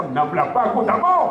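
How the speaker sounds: loud, fast, high comic outburst; mock indignant refusal. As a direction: blurt out a husband's exaggerated protest. Acted indignant refusal to wife.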